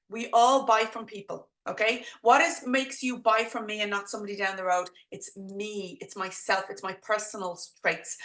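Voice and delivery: normal talking voice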